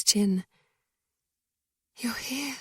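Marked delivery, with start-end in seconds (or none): 2.0-2.6 soft hushed whisper; barely voiced. A narrator's reading. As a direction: breathe it out quietly. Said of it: "I whisper".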